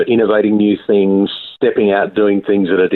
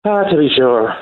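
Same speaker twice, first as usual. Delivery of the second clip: hammed-up stage-Irish sign-off, higher; mock Irish accent; 6 semitones higher than usual. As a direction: deliver the punchline in a jokey Irish brogue. April Fools reveal, mock Irish.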